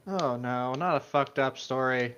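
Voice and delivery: ordinary delivery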